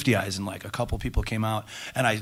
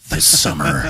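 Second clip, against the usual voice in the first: lightly hammed trailer announcer; booming teaser line, trailer style; movie trailer voice; 5 semitones higher, 7 dB louder, slower than usual. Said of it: "That's my movie voice".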